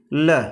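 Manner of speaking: "ल" as a lone "luh", dropped in pitch; 'ल' letter sound. Shown as a demonstration. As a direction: sound out L as a low "ल". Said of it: "एल का उच्चारण क्या होता है". ('ल' की ध्वनि, नीचे सुर में)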